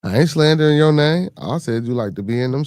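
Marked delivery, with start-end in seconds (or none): 0.0-1.3 louder and higher, pushing back at an accusation; defensive outburst. Real, not acted. Streamer to chat viewer who feels insulted, defensive raised voice. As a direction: snap back that you're not slandering her.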